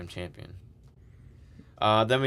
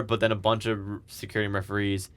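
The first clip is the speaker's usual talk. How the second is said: usual speaking voice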